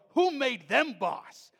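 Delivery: loud, high, slow mock challenge; hammed-up righteous defiance; imagined indignation. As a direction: act out an outraged demand. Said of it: "that's how I respond".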